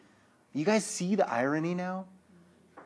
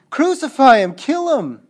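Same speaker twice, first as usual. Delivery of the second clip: loud, raised mob shout; high, forceful crowd cry; acted crowd yell; 6 semitones higher, 13 dB louder than usual. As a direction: imitate a crowd demanding an execution. Acts out the crowd's demand.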